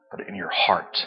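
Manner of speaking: whispered, barely voiced; hushed. Real, not acted. Pastor whispering to the congregation about the heart. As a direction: drop to a whisper so it lands in the heart.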